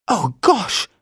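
high startled gasp of words. Acted. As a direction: sound suddenly alarmed. Judy startled by dripping water.

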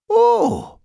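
very high, loud oh; startled housekeeper. A narrator's reading. Housekeeper hearing of the bun accident, startled. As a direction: exclaim high and sharp.

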